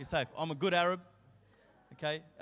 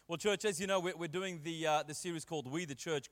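normal talking voice

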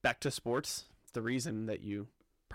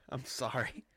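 apologizing through stifled laughter; words squeezed out past a giggle; trying not to laugh; 3 dB quieter than usual. Real, not acted. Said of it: "I'm trying not to laugh".

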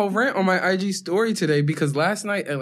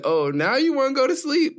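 put-on sarcastic girlfriend; 8 semitones higher than usual.

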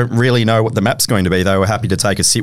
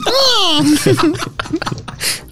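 seagull cry. Pretend. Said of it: "Can you do a seagull impression?".

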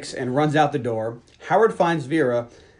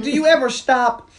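much higher, louder and slow; mock exasperation; 11 semitones higher, 6 dB louder than usual. Brantley to the groping Vera, higher, louder exasperation. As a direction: tease him with a drawn-out scold. Vera teasing while grabbing him.

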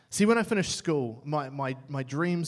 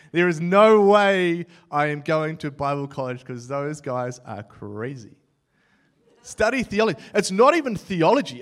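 louder, dismissive mock rant; scoffing younger self; 9 dB louder than usual. Teenage self scoffing at Bible college, loud scorn. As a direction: brush off the idea with scorn. Mocking his younger self's refusal.

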